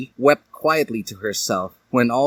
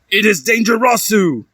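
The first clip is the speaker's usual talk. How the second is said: loud, high outburst; raised voice; 11 semitones higher, 5 dB louder than usual. A narrator's reading. Edward yells at Dali in the hallway. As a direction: raise your voice in anger. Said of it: "He began to raise his voice".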